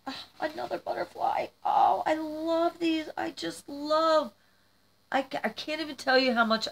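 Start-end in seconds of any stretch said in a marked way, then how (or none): 0.1-4.3 adoring delight. Real, not acted. Finding another butterfly in the box, delighted. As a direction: coo over it in a high voice.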